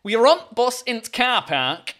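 slow, broad attempted Yorkshire; exaggerated Yorkshire dialect; Yorkshire accent. Shown as a demonstration. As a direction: lay on Yorkshire, clipping "the" to a t. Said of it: "try to do a Yorkshire accent".

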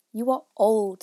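loud sing-song recital; poem recitation. A narrator's reading. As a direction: recite it firmly. Youth recitation.

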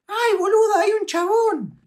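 put-on very high, loud girly shriek; imitated startled girl. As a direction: squeal it out like a shocked girl. Imitating startled girl finding him. (chillido agudo de mina sorprendida)

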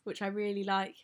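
ordinary talk

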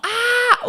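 high mock scream of pain; retold labour scream; imitated scream. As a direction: let out a sharp pained yell. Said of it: "O Renan fazia".

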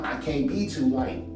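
ordinary delivery

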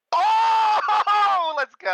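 excited shout. Real, not acted. Rolls a natural twenty.

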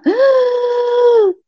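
long high-pitched strained inhale; gasping for air. Retold or imitated. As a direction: reenact a desperate, wheezing gasp for breath. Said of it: "comecei a puxar".

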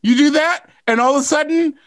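ordinary talk

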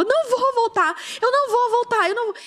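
high-pitched retold protest; frantic refusal. Wife refusing her husband's plea to go home, frantic. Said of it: "eu tava fora de mim".

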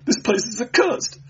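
high, shrill, fast panic; hysterical shrill. Acted. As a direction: babble it in terror. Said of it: "voice shrilled and cracked with hysterical excitement".

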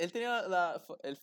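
usual speaking voice

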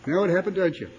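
ordinary talk

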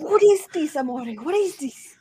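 high, loud hammed-up Italian husband; mock Italian husband. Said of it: "He's like".

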